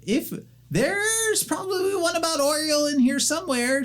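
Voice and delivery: very high, hurried panicky voice; young gnome caught without pants, flustered. Acted. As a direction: scramble to stall the visitor.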